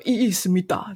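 mock Korean drama lawyer; playful Korean-style objection. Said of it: "在韩剧里面就是那个".

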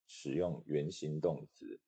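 normal talking voice

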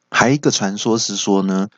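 usual speaking voice

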